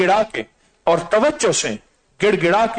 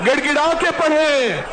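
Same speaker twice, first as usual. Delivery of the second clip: fervent preaching; 9 semitones higher than usual. Acted. Imam urging worshippers to weep while reciting, fervent. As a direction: urge them on with full force. Urges congregation at full force.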